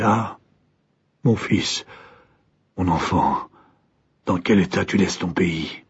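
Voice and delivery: a quietly weeping father's lament; tearful lament. A narrator's reading. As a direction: let the grief pull the voice down. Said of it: "il pleurait. Il se désolait".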